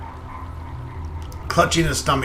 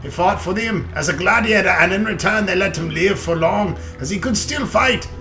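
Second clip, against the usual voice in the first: loud, higher hearty dwarf; 5 semitones higher, 3 dB louder than usual. Acted. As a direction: boom it out like an old dwarf. Reads dwarf lines in character.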